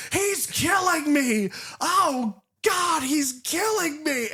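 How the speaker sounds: high, slow, panicked cry for help; mock terrified scream. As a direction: scream like a man being hacked apart. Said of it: "he's like".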